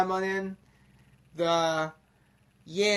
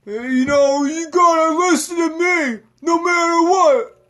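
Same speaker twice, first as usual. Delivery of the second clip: high, loud commanding yell; mock dictator bellowing demands; dictator shout; 7 semitones higher, 8 dB louder than usual. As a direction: scream orders like a tyrant. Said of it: "a fucking dictator".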